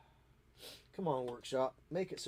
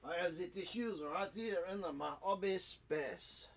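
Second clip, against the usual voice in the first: higher-pitched, hammed-up French accent; ridiculous French accent; 5 semitones higher, 3 dB quieter than usual. Modeller showing off tissues, ridiculous French accent. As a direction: lilt it in an absurd French accent. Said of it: "that is my ridiculous French accent".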